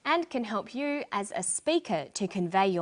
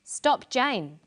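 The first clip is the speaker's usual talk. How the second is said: "Stop, Jane." said straight through with no break; no pause; 4 semitones higher, 4 dB louder than usual. Shown as a demonstration. Teacher repeating the no-comma version for learners. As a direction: run "Stop, Jane." together, falling on "Jane.". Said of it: "With no pause".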